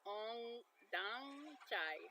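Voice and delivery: "ong dang chai" drawn out very slowly with careful tones; Vietnamese words, slowly. Shown as a demonstration. Learner reading a water bottle label aloud, slow.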